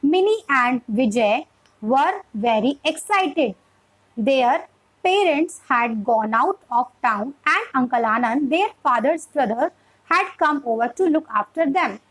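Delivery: slow, measured read-aloud with a pause at each full stop; model reading. Shown as a demonstration. Parent reading first for the child, slow model reading. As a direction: read it slowly in clear phrases for a learner. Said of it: "खुद read करना चाहिए पहले".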